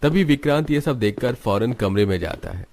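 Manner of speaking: normal talking voice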